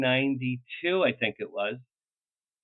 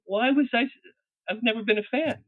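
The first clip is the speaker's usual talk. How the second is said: incredulous outburst; 9 semitones higher than usual. Real, not acted. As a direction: throw up the question, baffled. Exasperated about his own nerves.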